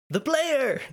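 high, put-on dramatic cry; Ephemer. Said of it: "Ephemer says".

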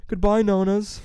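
loud, high farewell call; big drawn-out mock farewell; calling goodbye. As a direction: call out to the stores as you pass. Calling farewells to passing stores.

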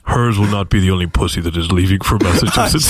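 deep, solemn voice from above; God voice. Pretend. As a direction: rebuke him like God. God keeps speaking.

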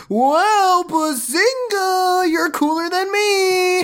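very high, slow put-on voice; exaggerated high, drawn-out character voice; Josh voice. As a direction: squeal it, stretching every word. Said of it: "did Josh voice".

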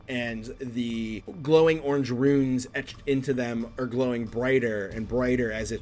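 slow, drawn-out suspenseful narration; dramatic tabletop storytelling; game master scene setting. Acted. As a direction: stretch out the key words as the threat builds.

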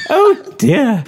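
dismayed. Pretend. Fussy diner exclaims in dismay.

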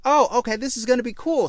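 high, eager burst of hype; high-pitched fake thrill; mock excitement. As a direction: gush like a fan who just got hooked. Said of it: "And I'm like".

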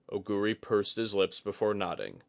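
usual speaking voice

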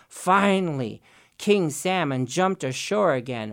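plain reading voice

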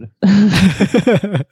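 slow mock-reluctant promise; slow, drawn out. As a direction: drag it out teasingly.

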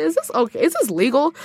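retold upset disbelief, pitched high. Patient questioning whether a doctor may laugh, upset. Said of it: "and I was so upset".

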